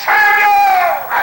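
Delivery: high, shouted summons; loud, sustained imitated divine cry; God calling out. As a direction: belt out the name as God's call. God calls the boy again.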